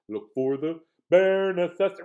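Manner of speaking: usual speaking voice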